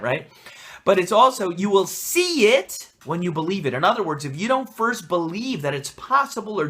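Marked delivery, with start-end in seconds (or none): none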